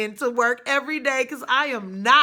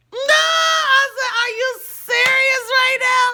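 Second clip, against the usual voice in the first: high, loud shout of disbelief; loud, high shocked shout; 10 dB louder than usual. Retold or imitated. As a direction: yell it, stunned he showed up. Retold shocked outburst.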